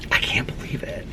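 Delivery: soft, disbelieving whisper; awed whisper. Real, not acted. Vlogger marvelling at nearly reaching a hundred books, awed whisper. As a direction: whisper it in amazement.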